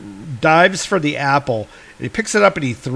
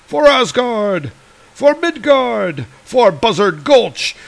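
higher, slow rallying cry of a cowboy; heroic battle cry; 8 semitones higher, slower than usual. Acted.

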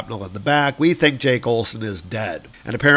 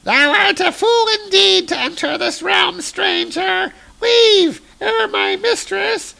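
very high, piercing otherworldly voice, rising to a shout on leave; shrill Valkyrie; 3 dB louder than usual. Acted. Vala warns the stranger off, very high and shrieking. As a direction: screech the warning at the stranger. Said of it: "And Vala says".

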